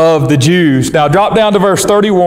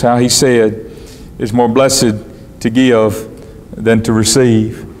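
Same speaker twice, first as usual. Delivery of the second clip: lower, measured reading of the Bible text; scripture reading; 5 semitones lower than usual. Acted. Preacher reading scripture to his congregation, solemn and measured. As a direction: read the verses solemnly to the congregation.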